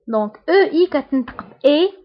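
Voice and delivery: usual speaking voice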